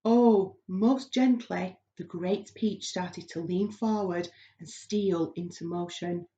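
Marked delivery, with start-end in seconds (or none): none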